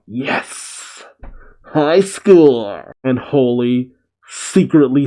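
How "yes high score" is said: mock triumphant scream. A narrator's reading. Young fighter blasting dragons, mock scream of triumph. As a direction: celebrate like a gamer beating a record. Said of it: "Shiki screaming out".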